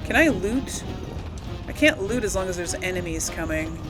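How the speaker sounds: ordinary delivery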